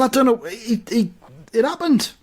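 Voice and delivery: high, stammering self-defence; defensive, halting protest; flustered excuse. Acted. Defending himself to upset listener.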